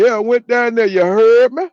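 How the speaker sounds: mock the cousin's catchphrase; New Orleans imitation.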